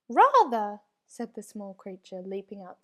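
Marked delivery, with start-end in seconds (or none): 0.1-0.8 loud, eager little yes. A narrator's reading. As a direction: jump at the offer. Creature eagerly agrees.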